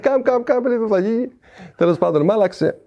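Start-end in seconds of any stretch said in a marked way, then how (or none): 0.0-1.3 high-pitched mock call to a young teammate; retold calling out. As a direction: re-enact calling someone over, pitch jumping well up. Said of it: "φώναζα γρημάκι στο μικρό το πιτσιρικά".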